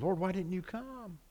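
grieving sisters rebuking him; reproachful questioning. Retold or imitated. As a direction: blame him for coming too late. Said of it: "they questioned".

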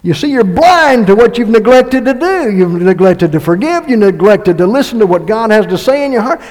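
loud, high, pressing sermon; impassioned pulpit preaching; preaching at full force. Acted. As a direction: plead with the congregation.

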